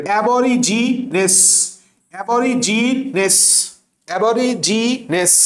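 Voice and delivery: অ্যাবরিজিনেস slow, ending clearly on -নেস; spelling pronunciation. Shown as a demonstration. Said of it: "এইভাবে পড়বা".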